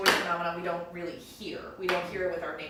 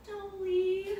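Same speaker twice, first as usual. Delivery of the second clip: put-on high little ghost child; little child's voice. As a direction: plead like a small child. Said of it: "we hear this little child's voice say".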